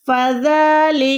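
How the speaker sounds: फ़ज़लिकल्लज़ी with a long held "dhaaa"; measured chanted फ़ज़लिकल्लज़ी, the taught way; stretched zaal. Shown as a demonstration. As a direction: recite फ़ज़लिकल्लज़ी, stretching "dhaa" and final "dhee". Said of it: "दोनों को खींचना है".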